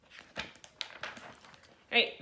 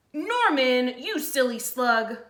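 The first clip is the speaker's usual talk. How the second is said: louder, annoyed telling-off; scolding snails; 5 dB louder, slower than usual. Acted. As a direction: scold him for spoiling the game. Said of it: "they cried".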